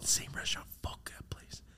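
whispered under the breath; hushed whisper in the car; whispered aside. Retold or imitated. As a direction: whisper it so nobody hears. Whispered aside mid story.

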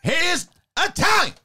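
high-pitched movie-star rant in separate bursts; Al Pacino. Acted. Actor argues with his director over casting, high Pacino impression. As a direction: climb high in mock indignation, dip, rise again. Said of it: "Pacino just went from being like that really high voice".

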